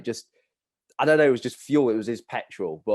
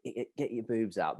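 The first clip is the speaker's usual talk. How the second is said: hushed crude heckle; 9 dB quieter than usual. Retold or imitated. Lad egging on his mates, hushed. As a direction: say it under your voice, much softer. Repeating crude chant, not yet shouted.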